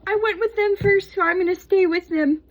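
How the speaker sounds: high, loud sing-song quote; mock loyal customer. Soap maker voicing her own loyalty to her supplier, high and bright. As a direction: chirp it like a devoted fan.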